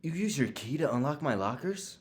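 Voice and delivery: usual speaking voice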